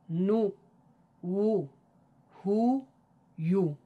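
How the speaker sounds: short rounded "جو، هو، خو" syllable by syllable; reciting alphabet with damma. Shown as a demonstration. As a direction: recite each letter with a short u for the class to repeat.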